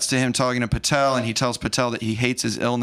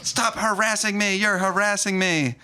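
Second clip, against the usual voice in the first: mock scream; 9 semitones higher than usual. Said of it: "she starts screaming at him".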